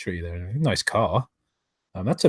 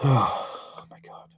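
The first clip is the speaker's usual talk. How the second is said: whispered exasperation; 5 dB quieter, much slower than usual. Real, not acted. Exasperated at book screenshot.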